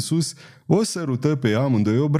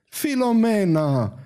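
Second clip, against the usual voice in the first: high, drawn-out cry of delight; delighted high exclamation, not shouted; joyful cry; 9 semitones higher, slower than usual. A narrator's reading. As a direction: call the name out slowly in happy surprise. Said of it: "scoase un strigăt de bucurie".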